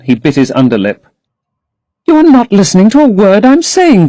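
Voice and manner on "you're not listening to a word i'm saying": put-on higher, young girl's plea; lightly raised girlish protest; Sybil crying out. A narrator's reading. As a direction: voice the eager young actress. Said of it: "cried Sybil".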